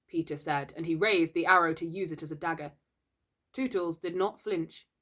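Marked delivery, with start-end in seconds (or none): none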